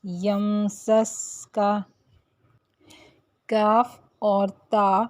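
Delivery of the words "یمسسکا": یمسسکا slow with a long hissing س; lengthened seen sound. Shown as a demonstration. Teacher reciting یمسسکا slowly to show the lengthened س. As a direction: hold the س in یمسسکا like a whistle. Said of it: "اس کو ہم واضح کر کے پڑھیں گے".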